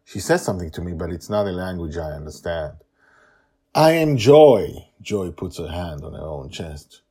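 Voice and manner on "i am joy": put-on slow, louder child voice; child introducing herself. Joy introducing herself to a stranger, slow and deliberate.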